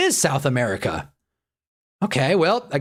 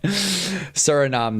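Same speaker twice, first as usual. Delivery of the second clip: slow drawn-out; 4 semitones higher, much slower than usual. Real, not acted. Typing the answer while saying it.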